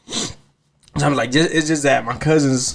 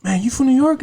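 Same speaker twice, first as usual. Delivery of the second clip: surprised question; 11 semitones higher than usual. Retold or imitated. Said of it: "the guy goes".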